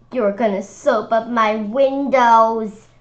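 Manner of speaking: slow, loud accusation with a pointed finger; accusing. A narrator's reading. Sister accusing him of soaping windows, loud and slow. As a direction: jab each word at him. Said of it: "pointing her finger at me".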